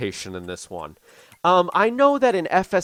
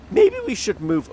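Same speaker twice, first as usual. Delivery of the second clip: higher pitch with an accidental crack; 7 semitones higher than usual. Real, not acted. Farmer gamer suggesting moving equipment, voice cracking. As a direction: let the voice break upward by accident. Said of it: "oh, my voice cracked".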